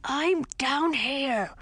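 high, slow, muffled from under bones. Acted. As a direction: call up from under a pile. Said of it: "said a muffled voice".

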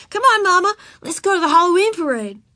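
put-on high, loud little-girl urging; impatient child. A narrator's reading. Ramona urges Mama to the parade, impatient high child voice. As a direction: hurry Mama along, eager and high.

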